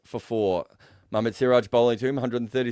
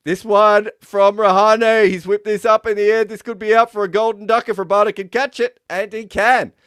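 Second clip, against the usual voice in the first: high, loud live commentary call; excited play-by-play; 9 semitones higher, 8 dB louder than usual. Acted. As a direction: call the wicket as it happens.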